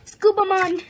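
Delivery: high and loud; excited announcement. Real, not acted. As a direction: call it out with delight. Announcing the toy.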